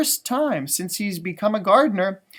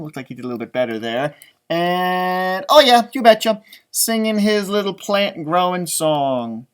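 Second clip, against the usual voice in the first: ordinary delivery, nothing marked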